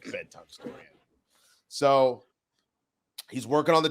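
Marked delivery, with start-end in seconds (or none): 0.1-1.0 breathy whispered echo of "A bedtime story animal."; hushed mock repeat of "A bedtime story animal.".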